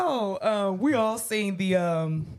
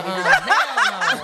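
laughing; 8 dB louder than usual. Real, not acted. Disgusted laughing reaction.

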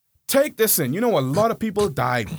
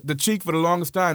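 usual speaking voice

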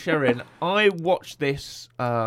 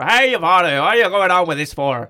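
loud hammed-up Irish yelling; rowdy, garbled Irish shouting match; shouting Irish people; 3 semitones higher, 10 dB louder than usual. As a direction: shout it in a mock Irish accent. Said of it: "A lot of Irish people shouting at her".

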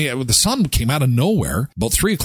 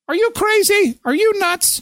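mock outraged wife. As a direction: squeal the wife's disbelief. Acted quarrel, wife voiced.

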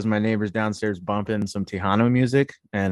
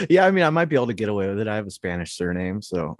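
ordinary talk

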